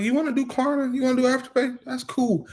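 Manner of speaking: high, breezy fake-agreeable voice; mock high voice. Husband voicing himself to wife about Klarna, high teasing voice. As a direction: play it sweet and high, half teasing. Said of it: "I be like".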